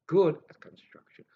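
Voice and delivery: a secretive whispered echo; whispered aside. Real, not acted. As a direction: whisper "Good at construction" as a hushed aside. Repeats line, then whispers it.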